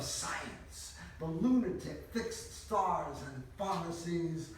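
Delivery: declaimed Dada poem, slow emphatic openings; poem recitation. Acted. Reading a Dada poem from an earlier book, emphatic declamation. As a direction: declaim the poem, drawing out key words.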